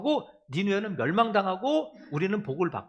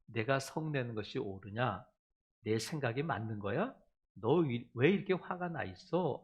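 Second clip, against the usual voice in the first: gentle questioning; 8 semitones lower, 5 dB quieter than usual. Acted. God softly asking the angry believer to look back. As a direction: lower and softer, tender asking.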